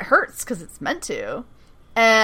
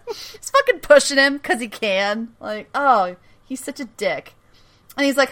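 ordinary talk, nothing marked

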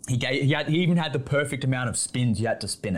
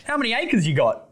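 hearty dad-chat voice; 10 semitones higher than usual. Retold or imitated. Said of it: "change your whole cadence of talking".

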